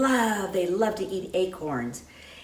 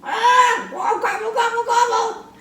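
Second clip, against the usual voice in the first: high, loud shouted gobbles; wild turkey shout; 8 dB louder than usual. Acted. Wild turkey puppet shouting its gobble at the host. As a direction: gobble wildly at full voice. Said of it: "Are you wild?".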